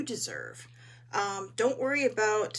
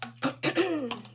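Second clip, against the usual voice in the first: throat clearing; 6 semitones higher, 3 dB louder than usual. Real, not acted. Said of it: "My throat is determined".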